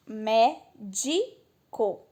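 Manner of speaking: médico broken slowly into "mé", "di", "co"; very slow teaching breakdown of médico; syllable by syllable. Shown as a demonstration. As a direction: separate each syllable of médico with gaps. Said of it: "o di que teria que ser mais forte".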